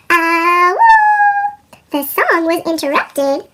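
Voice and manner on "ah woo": a loud rising howl soaring very high; dog howl. Acted. Olive joining the song with a loud, soaring howl. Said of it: "she howled".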